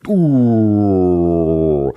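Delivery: usual speaking voice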